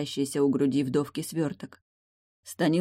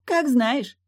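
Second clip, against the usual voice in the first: high, loud, dismissive mermaid voice; offhand mermaid; 10 semitones higher, 5 dB louder than usual. Acted. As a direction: shrug it off in a high pitch. Mermaid shrugs it off.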